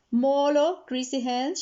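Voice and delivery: loud call over engine noise; shout over the noise. Acted. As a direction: call out above the revving car. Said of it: "shouted Mama over the noise".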